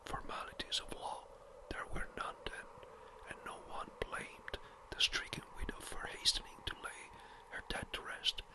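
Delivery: usual speaking voice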